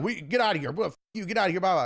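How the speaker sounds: lightly mock an angry man shooing people off; mock irate neighbor. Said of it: "And he's like".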